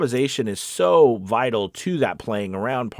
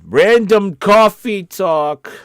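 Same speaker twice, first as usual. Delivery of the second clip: loud, high, drawn-out segment announcement; mock announcer; 10 semitones higher, 10 dB louder, much slower than usual. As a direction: belt it out slowly like a show title. Announces his side segment like jingle.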